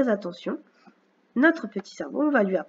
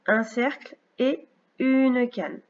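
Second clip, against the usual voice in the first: slow and raised in pitch at the start, with pauses; slow step-by-step; 3 semitones higher, slower than usual. Shown as a demonstration. Teacher reminding pupil how to form the letter, slowly step by step. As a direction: draw out each stroke as it is named.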